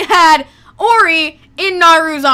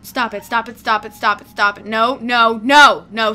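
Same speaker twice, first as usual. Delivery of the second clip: ordinary talk, nothing marked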